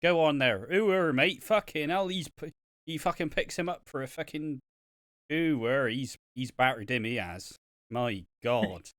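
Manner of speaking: hammed-up Irish farmer ringside. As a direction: cheer him on like a rural fan. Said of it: "in the farmer voice".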